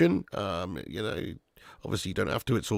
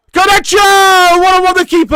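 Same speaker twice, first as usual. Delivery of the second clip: excited commentator shout; 13 dB louder than usual. Real, not acted. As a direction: scream the player's name as he breaks through. Live commentary of a chance.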